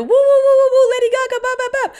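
high, loud whooping sing-song; hammed-up, high-pitched celebration; mock party cheering. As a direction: whoop it up like a parade reveller. Mimics showy Pride celebrating.

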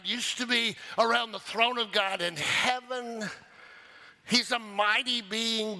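high, driving pulpit delivery; impassioned preaching. Real, not acted. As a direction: preach it with full energy. Preaches with rising force.

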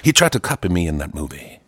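a touch of [name], lightly voiced. Host answering as [name], a hint of the voice. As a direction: claim to be the real [name], half-hearted. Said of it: "You're like Forrest Gump [name] now".

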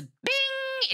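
high, drawn-out "bing"; bright ding imitation. Showing how instantly the pregnancy test reacts, high sung-out bing. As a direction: ring it out like a bell.

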